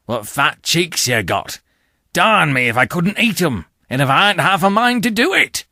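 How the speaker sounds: put-on hungry convict taunting a small boy; menacing convict. A narrator's reading. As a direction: gloat over the boy's cheeks, building to a threat.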